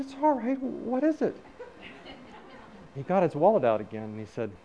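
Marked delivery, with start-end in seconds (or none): 0.0-1.3 high-pitched retold reassurance; gentle reassurance. Speaker answering the shaking man, high-pitched reassurance. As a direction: reassure a nervous stranger in a raised voice.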